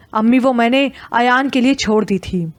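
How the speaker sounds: ordinary delivery, nothing marked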